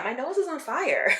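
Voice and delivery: laughing through the words; giggling as she talks. Real, not acted. Laughing about burning nose.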